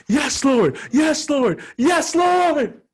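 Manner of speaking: full-force high scream, acted out. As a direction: shout it to heaven, building each time. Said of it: "I just kept screaming".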